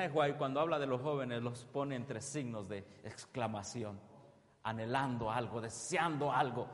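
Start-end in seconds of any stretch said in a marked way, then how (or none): none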